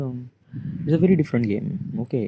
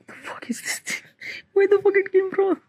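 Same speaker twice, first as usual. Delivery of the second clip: tearful disbelief. Real, not acted. Vlogger asking where his tears came from, tearful. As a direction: voice jumping high through a tearful laugh. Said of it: "These are come from, the tears are actually came".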